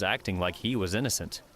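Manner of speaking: plain reading voice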